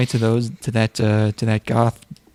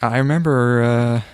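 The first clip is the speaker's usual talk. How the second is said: ordinary talk, nothing marked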